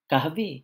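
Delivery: "কাহাবি" lifted at the end like a question; rising question tune. Shown as a demonstration. Teacher repeating the coffee offer word, rising question tune on "কাহাবি". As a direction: let the end of "কাহাবি" climb. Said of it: "উত্থান হবে".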